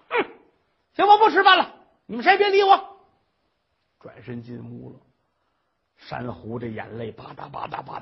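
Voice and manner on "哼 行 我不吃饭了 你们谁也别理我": very loud, high tantrum; shrieking sulky old mother. Acted. Mother-in-law throws a tantrum at the family, loud and high. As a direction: storm off in a huff.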